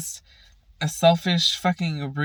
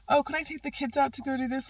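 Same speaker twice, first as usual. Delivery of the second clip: much higher mock sugary ask; mocking sweet grandma; 11 semitones higher, faster than usual. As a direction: parody a doting grandma request. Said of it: "She doesn't hit them up to be like".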